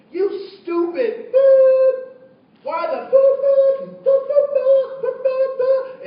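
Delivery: furious shout, words bleeped out; angry cussing. Retold or imitated. Man in the store cussing out the young woman, furious shout. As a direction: yell at her, high and loud. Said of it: "the guy turns around and he says".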